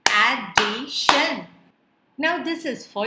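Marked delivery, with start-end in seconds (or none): 0.1-1.5 "Addition" slow and loud, ad, di, tion; syllable by syllable. Shown as a demonstration. Teacher answering the syllable count, "Addition" split in three. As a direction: punch three separate beats in "Addition". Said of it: "How many syllables are there".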